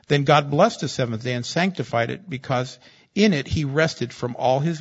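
fervent sermon reading; preaching. Acted. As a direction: proclaim it from the pulpit. Preaching scripture at full force.

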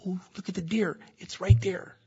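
jokingly voiced hunter; mock hunter. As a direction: pretend eager hunter spotting a deer. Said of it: "No, I'm kidding".

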